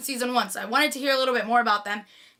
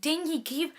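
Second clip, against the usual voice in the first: ordinary talk, nothing marked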